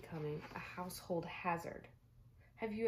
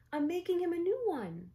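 put-on much higher, louder boyish insistence; a small boy defending his broken toy; eager little boy; 12 semitones higher, 8 dB louder than usual. Spencer insisting to his mom, high and loud.

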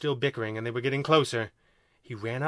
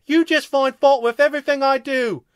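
high, loud aggrieved alien voice; indignant accusation; 12 semitones higher, 8 dB louder than usual. A narrator's reading. Second alien accusing first of fault-finding, indignant.

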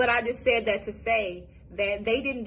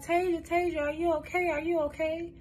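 lightly voiced sister, higher and anxious; a hint of a scared big sister calling her name; retelling her sister calling out to her, higher and worried; 8 semitones higher than usual. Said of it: "And she was like".

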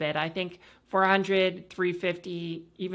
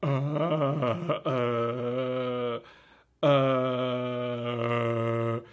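dropping the voice as low as it goes; lowered voice; 5 semitones lower, much slower than usual. Shown as a demonstration. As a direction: sink down to the bottom of the range. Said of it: "I'm just gonna lower my voice and let's see how low we can go".

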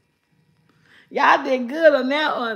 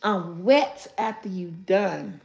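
quieter repeat; 7 semitones lower, 6 dB quieter than usual. Shown as a demonstration. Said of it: "repeats riddle lower, softer".

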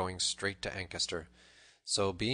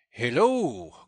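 loud, higher challenging call; bold hail; 4 semitones higher, 9 dB louder than usual. A narrator's reading. Little John, spotted by the tanner, calls out boldly. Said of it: "put a bold face upon the matter".

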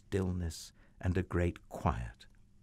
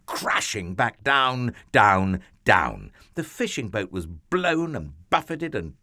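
high, loud, swooping storyteller voice; dramatic storm narration; 5 semitones higher, 5 dB louder than usual. A narrator's reading. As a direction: build the storm's tumult, hitting each motion.

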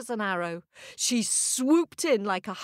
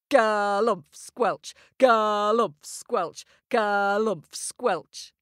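stomping footsteps; slower than usual. Acted. Children join footsteps sound.

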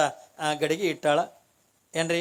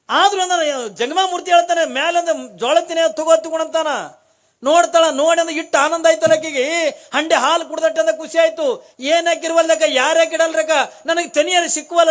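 higher, louder rejoicing sermon voice; joyful preaching; 8 semitones higher, 8 dB louder than usual. Acted. Storyteller to devotees telling the widow's joy, joyful preaching. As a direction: proclaim her joy to the hall.

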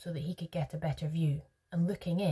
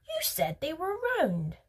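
put-on high-pitched childish accusation; accusing; 12 semitones higher than usual. A narrator's reading. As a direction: throw it back as an accusation. Veruca accuses Wonka in a quarrel.

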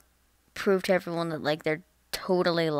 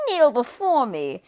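high, loud, slow command; power-mad fifth grader; 5 semitones higher, 5 dB louder, slower than usual. Acted. Fifth grader ordering everyone to bow, mock-imperious. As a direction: proclaim it like a mock tyrant. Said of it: "you get to fifth grade".

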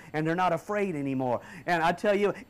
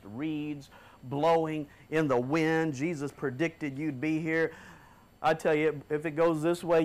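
solemn rebuke; 5 semitones lower than usual. Acted. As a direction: pronounce judgment like an old prophet. Prophetic rebuke, low and slow.

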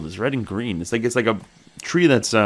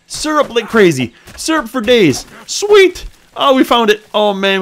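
high, loud, gleeful cheering; overjoyed; 12 semitones higher, 10 dB louder, slower than usual. Real, not acted. Gamer cheering over the syrup, loud and high. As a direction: crow over the big find.

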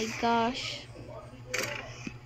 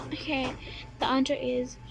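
ordinary delivery

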